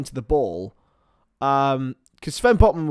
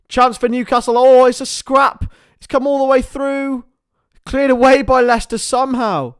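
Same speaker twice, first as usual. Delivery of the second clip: excited play-by-play; 7 semitones higher, 9 dB louder than usual. Real, not acted. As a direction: voice rising into loud, high match commentary, then easing. Live play-by-play of goalmouth scrap and foul.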